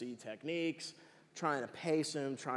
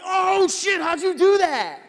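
loud, high, laughing amazement; relieved guard marvels at the silence, laughing loudly; amazed laughing; 14 dB louder, slower than usual. Retold or imitated. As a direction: blurt it out laughing in disbelief. Guard amazed alarm stopped.

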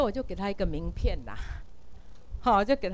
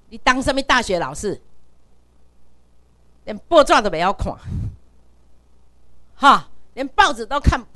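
retold insult; 6 dB louder than usual. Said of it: "最后搁甲我讲一句".

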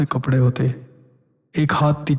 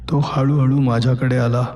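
usual speaking voice

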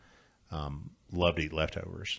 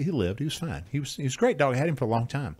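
normal talking voice

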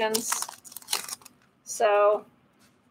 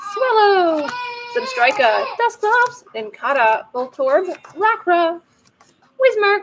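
mock Pokemon cries; 8 semitones higher, 4 dB louder than usual. Voicing cards with cries.

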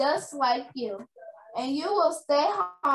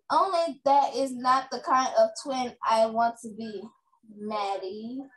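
ordinary delivery

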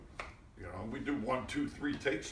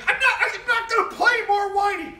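high, loud shouted refusal; 12 dB louder than usual. Retold or imitated. Actor refusing to play more whiny, shouted refusal. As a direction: yell that you won't do it.

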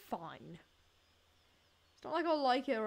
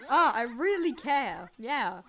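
loud, slow mock sincerity; sarcastic; 9 dB louder, slower than usual. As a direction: overplay caring, slowly and louder. Picking heartfelt compliment option.

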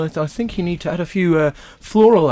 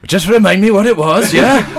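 put-on higher, louder lilting Welsh accent; 5 semitones higher, 5 dB louder than usual. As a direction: chirp it in a bad Welsh accent. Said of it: "accent change to Welsh".

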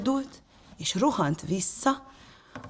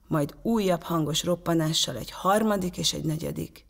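ordinary delivery, nothing marked

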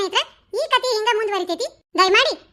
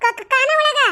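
higher, taken-aback question; stammering disbelief; 4 semitones higher, slower than usual. Acted. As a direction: stutter the first sound in dismay. Wife taken aback by his suggestion. (ತೊದಲುತ್ತಾ ಅಚ್ಚರಿಯಿಂದ ಕೇಳುವುದು)

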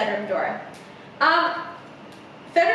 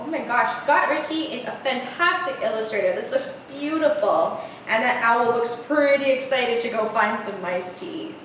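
plain reading voice

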